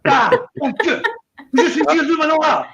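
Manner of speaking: high-pitched mock French rant; hammed-up French fury; furious French screaming. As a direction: rant in French, comically outraged. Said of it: "To the scream of furious French".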